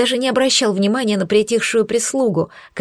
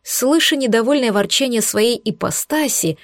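ordinary delivery, nothing marked

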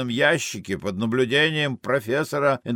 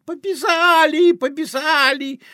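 put-on high, loud little boy lisping; boy's shout; 4 dB louder than usual. Greek boy dancing on the fence, high lisping shout. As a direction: squeal it like an excited, lisping urchin. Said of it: "кричит".

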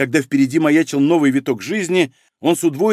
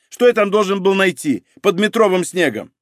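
higher-pitched agitated protest; nervy retort; 6 semitones higher than usual. Acted. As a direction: argue back with nervous indignation. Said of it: "нервно ответил криминалист". (нервно возражая)

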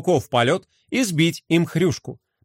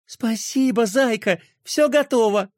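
bright joyful thanks, higher; happy and delighted; 6 semitones higher than usual. A narrator's reading. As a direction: gush with delight. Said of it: "обрадовалась Пинки".

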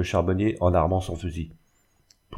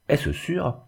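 higher, mildly incredulous question; astonished question; 7 semitones higher than usual. A narrator's reading. Charcoal burner doubting the news of a marriage, astonished. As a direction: ask for confirmation in surprise. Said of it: "murmura Joël étonné".

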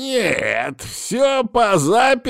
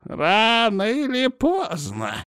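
put-on high, slow grumbling old man; displeased drawl; 13 semitones higher, much slower than usual. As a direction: drag it out in annoyance. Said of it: "недовольно протянул лекарь".

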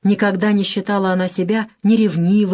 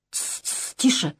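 whispered shush, then a higher hush; 5 semitones higher, 7 dB quieter than usual. A narrator's reading. Guest hushing Sonya's outburst at table, in a hushed whisper. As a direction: shush someone under your breath.

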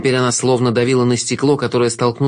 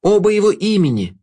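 high, astonished exclamation; high, emphatic outcry, not truly shouted; crowd's cry; 9 semitones higher than usual. A narrator's reading. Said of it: "закричали в толпе".

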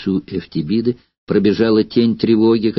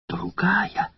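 whispered question; 8 semitones higher, 8 dB quieter than usual. Acted. Метробий переспрашивает.

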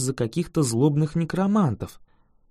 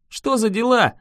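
higher-pitched indignant question; 6 semitones higher than usual. A narrator's reading. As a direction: demand an explanation, voice lifted. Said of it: "возмутился я".

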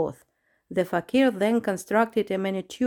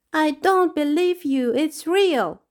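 higher and louder, whiny protest; whining; 8 semitones higher, 5 dB louder than usual. Acted. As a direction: whine like a let-down child. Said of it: "the lizard whined".

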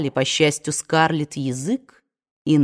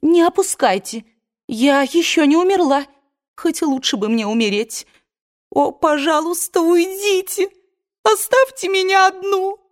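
tearful fury; 11 semitones higher than usual. A narrator's reading. Scarlett drives the women away, tearful and furious. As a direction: scream at them to leave. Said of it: "яростно вскричала вдруг Скарлетт".

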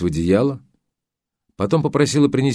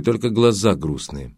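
plain reading voice

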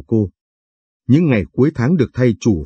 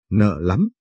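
shy scolding; 3 dB quieter than usual. Acted. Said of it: "đập tay vào đầu tôi bảo".

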